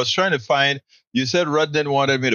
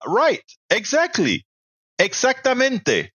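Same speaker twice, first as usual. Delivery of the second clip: eager agreement; 10 semitones higher than usual. Real, not acted. Host cheers a donor's comment.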